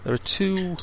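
slow, hushed scene-setting narration; game master scene setting. A narrator's reading. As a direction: paint the gloomy crypt slowly. GM describes the crypt scene.

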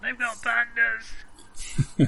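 slow, high hammed-up yell; drawn-out mock alarm call; mock shout. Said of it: "muffled voice shouting something about pandas".

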